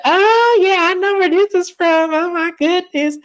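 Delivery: high squealing mock excitement. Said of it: "And she said".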